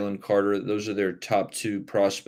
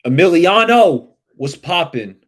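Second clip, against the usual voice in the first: loud, high hail that stretches the name; called-out greeting; 7 semitones higher, 12 dB louder than usual. Real, not acted. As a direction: holler hello to a viewer.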